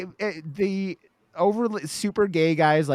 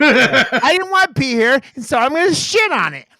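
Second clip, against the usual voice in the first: put-on whiny toddler; 11 semitones higher, 8 dB louder than usual. Mocking toddler attitude, laughing.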